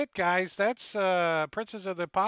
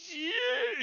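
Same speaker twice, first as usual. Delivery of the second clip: very high loud cry; drunken yell; 5 dB louder than usual. Acted. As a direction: whoop it out. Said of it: "And he's like".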